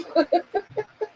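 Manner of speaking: high squealing laugh, soft; a real helpless giggle escaping. Real, not acted. Herself in front of her ex, a helpless high giggle. Can't hold back the laugh.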